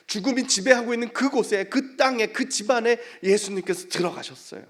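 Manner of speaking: loud, high, insistent phrases; forceful preaching. Real, not acted. Preacher tells of Jesus entering the house, forceful preaching. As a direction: proclaim it phrase by phrase.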